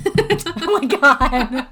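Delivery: slow, loud, laughing exclamation; drawn out while cracking up. Real, not acted. Cracking up at the question.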